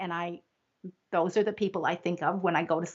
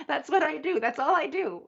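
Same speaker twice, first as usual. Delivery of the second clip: higher, laughing through the words; 8 semitones higher than usual. Real, not acted. Guest laughing to the host about her calling. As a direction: laugh at her own realization.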